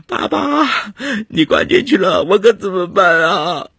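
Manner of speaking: high-pitched anguished wail, drawn out at the end; heartrending cry. A narrator's reading. Girl clinging to her father as he is detained, heartrending cry. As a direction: wail it out, loud and desperate. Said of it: "撕心裂肺地喊道".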